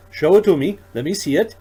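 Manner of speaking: suddenly lit up with longing; eager excitement. A narrator's reading. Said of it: "light up and holds out his hands".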